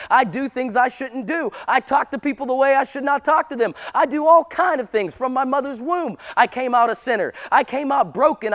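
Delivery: high-pitched, driving pulpit delivery; impassioned preaching. Real, not acted. Preacher confessing he came out a sinner, impassioned. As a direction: preach it at full force, line after line.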